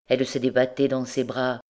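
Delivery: ordinary delivery, nothing marked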